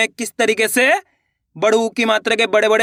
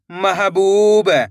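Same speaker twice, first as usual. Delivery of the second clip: syllables joined into one slow word; blended word; much slower than usual. Shown as a demonstration. Teacher joining the syllables for learners, slowly blended. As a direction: read it as one whole, stretched word. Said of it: "इनको आपस में लाकर पढ़ना है".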